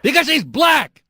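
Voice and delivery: high, loud, hammered outburst; exasperated shout. Real, not acted. Host cutting off birther caller, exasperated shout. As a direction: shout it slowly to shut the caller down.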